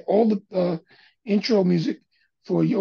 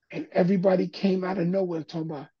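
ordinary talk

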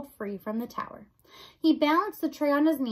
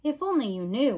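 ordinary delivery, nothing marked

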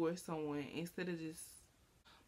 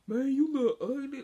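louder, higher mocking jab; mock taunt; 6 semitones higher, 7 dB louder than usual. Imitating a boy friend's insult, louder and taunting. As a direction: throw the insult carelessly. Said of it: "they'll be checking you".

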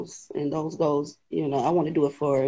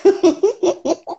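loud, high gasping laugh through the words; real overwhelmed joy, voice pushed up; overjoyed, near tears; 9 semitones higher, 6 dB louder than usual. Real, not acted. Winner just picked on a live, overwhelmed and tearful. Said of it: "I'm about to cry".

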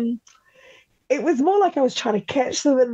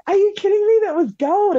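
excited disbelief; 11 semitones higher than usual. Retold or imitated. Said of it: "And I was just like".

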